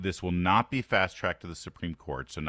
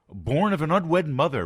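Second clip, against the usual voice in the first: mocking activists; 8 semitones higher, 6 dB louder than usual. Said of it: "activists and politicians and media types".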